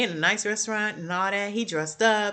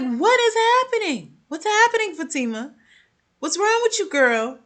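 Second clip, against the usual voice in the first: high, loud exasperated what-is-happening; disbelief; 10 semitones higher, 4 dB louder than usual. Retold or imitated. Talking to the screen at Fatima, high exasperated disbelief. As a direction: throw your hands up at Fatima.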